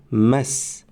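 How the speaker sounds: مس drawn out slowly, stopping on the silent س; slow demo مس. Shown as a demonstration. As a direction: hold مس slow and stop on the s. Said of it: "آواز میم با فتحه به بالای حرف سین".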